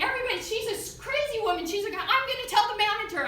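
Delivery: high, loud mock public denunciation; imitated screaming. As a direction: shriek it to the whole room as an outraged woman. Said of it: "she's screaming at me again".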